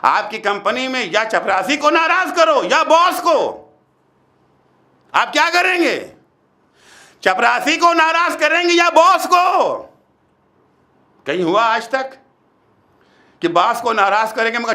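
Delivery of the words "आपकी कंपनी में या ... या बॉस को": raised, challenging sermon questions; forceful preaching. Real, not acted. Preacher putting the boss-or-peon choice to listeners, forceful preaching. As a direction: press the congregation for an answer.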